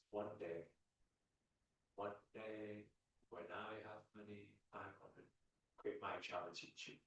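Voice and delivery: soft, low, acted daydream; wistful someday voice. Imitating someone putting off service until money comes, wistful someday voice. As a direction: trail along quietly with long pauses.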